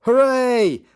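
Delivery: high, loud, stretched hooray; crowd cheer. Acted. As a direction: cheer like a party of delighted kids. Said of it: "they all clapped".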